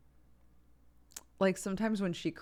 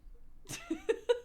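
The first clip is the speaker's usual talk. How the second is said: high laughter; real laugh, up high. Real, not acted. Mother laughs.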